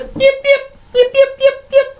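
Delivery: very high yipping kobold cries; high panicked yips. Acted. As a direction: yelp like a terrified little kobold. DM voices cowering kobold.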